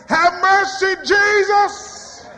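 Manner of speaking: high, loud desperate cry; lepers shouting. Acted. Lepers calling to Jesus from afar, loud desperate cry.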